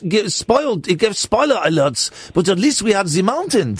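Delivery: mock-mournful Swiss accent; hammed-up Swiss villager's accent. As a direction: lament in a comic Swiss accent. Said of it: "It's a Swiss accent".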